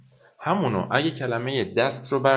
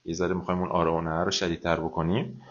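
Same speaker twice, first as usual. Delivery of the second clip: ordinary talk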